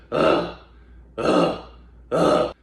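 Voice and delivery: short upset sighs, pushed out; angry sighing grunt. Shown as a demonstration. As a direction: sigh like you're upset. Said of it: "Act like you're getting upset, like you're sighing".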